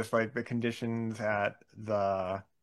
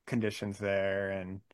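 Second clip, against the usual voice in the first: normal talking voice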